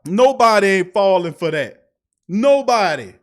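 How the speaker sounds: loud, insistent declaration; emphatic disbelief. Real, not acted. Fan ridiculing the play action, loud disbelief. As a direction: hammer it home at full voice. Incredulous rant about play call.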